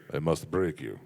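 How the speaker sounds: low-pitched Russian film-villain impression; Dolph Lundgren. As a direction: drop low into a menacing Russian accent. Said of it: "like Dolph Lundgren in the Rocky movie".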